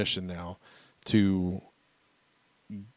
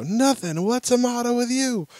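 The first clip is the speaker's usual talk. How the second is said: gleeful punchline; 14 semitones higher, 6 dB louder than usual. Retold or imitated. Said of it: "And I go".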